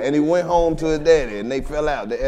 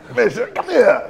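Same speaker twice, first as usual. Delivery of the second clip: mock proud boyfriend; 5 semitones higher than usual.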